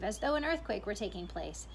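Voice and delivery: ordinary delivery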